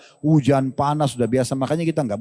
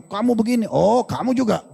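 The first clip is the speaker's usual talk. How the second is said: mock quarrel; 6 semitones higher than usual. Acted husband-wife quarrel over blame.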